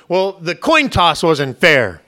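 mock petulant protest; sore-loser whine. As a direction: drag out the grievance like a sore loser. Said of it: "evil people ... who will always argue".

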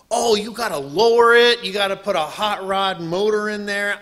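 loud, pushing brother; pushy brother. Retold or imitated. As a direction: urge it loudly. Brother pushing hot rod idea.

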